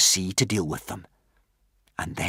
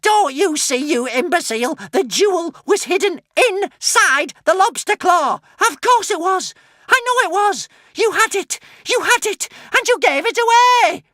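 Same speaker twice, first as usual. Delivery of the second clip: high loud raging scolding; furious witch; 9 dB louder than usual. A narrator's reading. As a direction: scream it at the fool.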